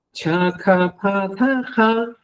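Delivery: "chacapataja" very slow with a puff of breath after ch, k, p, t; aspirated stops. Shown as a demonstration. Teacher demonstrates aspirated stops for his class. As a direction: breathe out hard on each stop of "chacapataja". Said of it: "como si estaría soplando".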